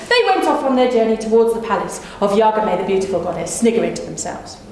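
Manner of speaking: plain reading voice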